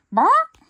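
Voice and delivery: shrill, high, loud squawking "Bark!"; chicken squawk. Acted. Guard chicken squawking at the thief, loud high squawk. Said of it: "squawks the chicken".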